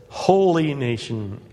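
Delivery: "holy" held long and loud; stress on holy. Real, not acted. Preacher returning to who the congregation is, stress on holy. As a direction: punch out "holy" with a stretched first syllable. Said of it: "Emphasizing the word holy".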